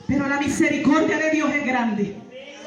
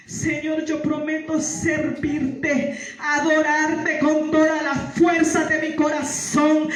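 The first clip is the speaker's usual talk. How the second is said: normal talking voice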